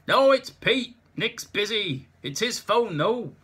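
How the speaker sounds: loud, high shouting over a racket; shouting over noise. Acted. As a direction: bellow down the line. Shouting over noisy phone line.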